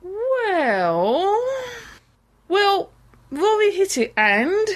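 put-on sheepish girl confessing slowly; Amy voice. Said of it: "And in comes Amy".

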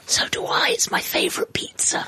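hushed voiceless whisper; whisper yelling. Real, not acted. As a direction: whisper it with energy. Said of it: "What are we whispering?".

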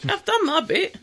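squeaky high put-on voice. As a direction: say it in a silly high voice.

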